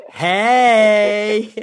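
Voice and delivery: loud high hey. Retold or imitated. Host answers Eric's greeting with a loud high hey. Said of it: "And I was like".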